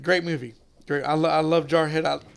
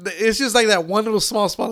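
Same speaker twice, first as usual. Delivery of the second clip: ordinary talk, nothing marked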